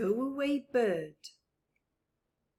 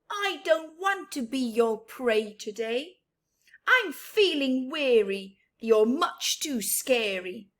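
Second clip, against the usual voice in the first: frightened, cross refusal a bit louder; grumpy go-away bird, louder; 4 semitones higher, 7 dB louder than usual. Acted. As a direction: push the scary bird away.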